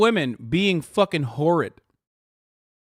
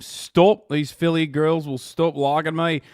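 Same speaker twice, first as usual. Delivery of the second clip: normal talking voice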